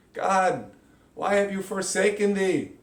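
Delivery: loud, high hammed-up wail to God; overacted biblical lament; mock plea to heaven. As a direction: cry out to God in mock despair.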